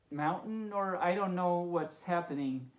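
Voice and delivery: ordinary delivery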